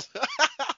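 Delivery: squeaky burst of laughter; high-pitched laugh. Real, not acted. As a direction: break into a high giggle. Cracking up at trade.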